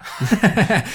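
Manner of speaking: a short laugh that falls away; embarrassed laugh. Real, not acted. Laughing to the interviewer that his code is gone. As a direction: laugh at yourself.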